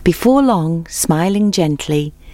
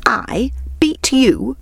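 high, slow, incredulous storybook exclamation; scoffing hare's astonished question; exclaiming; 8 semitones higher, slower than usual. A narrator's reading. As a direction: break it up in mock disbelief. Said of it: "exclaimed the hare".

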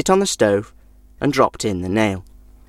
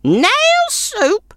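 much higher, scornful crone; snorting old woman. A narrator's reading. Old woman scoffing at the stranger, high scornful snort. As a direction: scoff at the silly idea. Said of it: "snorted the old woman".

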